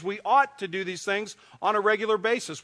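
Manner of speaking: ordinary talk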